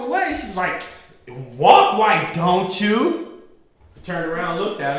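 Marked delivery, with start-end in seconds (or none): 1.6-3.4 loud, slow and drawn out; mock rude jab. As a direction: imitate a loud, pushy customer. Rude customer's jab, retold.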